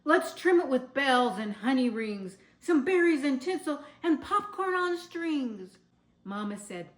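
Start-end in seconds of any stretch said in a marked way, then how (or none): 0.0-5.8 excited plans. A narrator's reading. Barnaby excited about trimming.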